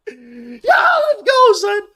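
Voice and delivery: high, loud victory yell; excited shout. Real, not acted. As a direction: scream let's go for the win. Celebrating Brunson's win.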